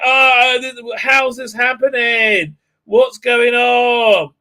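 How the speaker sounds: high, loud panicked yelling, slow; hammed-up alarm; mock panic. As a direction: crew shouting in mock fright about the trap. Crew panicking.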